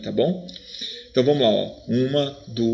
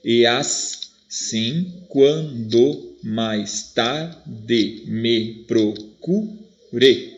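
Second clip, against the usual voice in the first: "E assim, quando mais tarde me procure" chopped into slow separate syllables; much slower than usual. Shown as a demonstration. Teacher counting the poem's metrical syllables, each syllable spaced out slowly. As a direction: scan it very slowly, "e as-sim quan-do mais tar-de me pro-cu-re". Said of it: "vamos ver quantas sílabas métricas".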